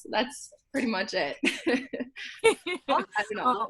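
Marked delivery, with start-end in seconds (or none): 1.4-3.7 high giggly wrap-up; giggling. Real, not acted. Wrapping up her story for the interviewer, giggling. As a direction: laugh it off, much higher.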